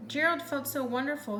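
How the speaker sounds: ordinary delivery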